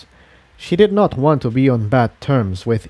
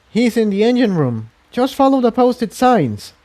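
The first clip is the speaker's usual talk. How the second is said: higher, helpful mare voice; lightly voiced pony; 10 semitones higher than usual. A narrator's reading. Tiptoe directing Incendia, lightly voiced. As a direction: point the way kindly.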